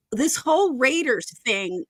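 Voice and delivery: normal talking voice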